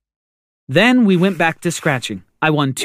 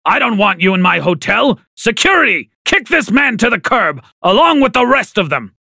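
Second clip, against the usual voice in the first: high, outraged yelling; shocked anger; 6 semitones higher, 3 dB louder than usual. A narrator's reading. Father discovering his brother in the hotel, furious. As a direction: order him thrown out, furious. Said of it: "Dad was so angry".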